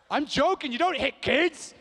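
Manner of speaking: high, loud comic backpedal; high-pitched, emphatic disclaimer; loud protesting retraction. Real, not acted. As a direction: shout it in mock alarm. Loudly retracting the joke.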